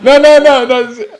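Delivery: loud, high, laughing no; laughing denial. Real, not acted. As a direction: laugh it off loudly. Laughing off credit for baby.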